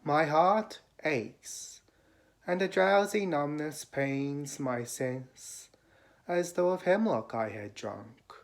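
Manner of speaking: measured, lifted poetry reading with pauses between phrases; poem recitation. Acted. Reader reciting Keats' ode to listeners, lyrical poem recitation. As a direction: recite it as verse, rising on the opening cries and sinking at line ends.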